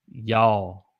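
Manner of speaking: y'all as one syllable, yawl; Southern y'all. Shown as a demonstration. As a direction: say y'all slow and long. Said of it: "Try to say it with me".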